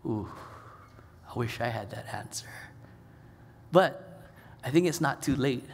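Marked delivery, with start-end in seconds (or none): 0.1-2.5 a whispered, wistful aside. Real, not acted. Preacher admitting envy to his congregation, whispered. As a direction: whisper it as a private regret.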